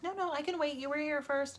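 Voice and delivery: a hint of a polite old lady insisting; older woman. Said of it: "she's like".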